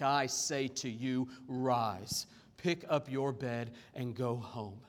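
low, slow, measured authority; solemn command. Acted. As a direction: command it gravely, lowering the pitch. Jesus' actual command to paralytic.

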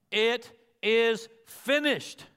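very slow, each word weighted; solemn emphasis. Real, not acted. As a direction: proclaim it word by word. Climax of the sermon point.

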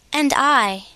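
ordinary delivery

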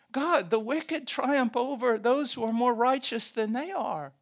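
high, loud complaint to God; protesting prophets. Acted. Prophets join the chorus against God, loudly protesting. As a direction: cry out against injustice. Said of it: "Even the prophets, right? Join in the chorus".

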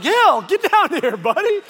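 loud, high call up the stairs; calling upstairs to his son. Retold or imitated. Dad calling his second grader down for a talk, loud and high. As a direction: shout for the boy to come down, not angry. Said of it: "now I'm not yelling at Laura".

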